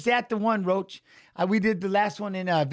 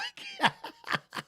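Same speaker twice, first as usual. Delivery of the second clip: high squealing laugh; 8 dB quieter than usual. Real, not acted. Streamer cracking up at chat.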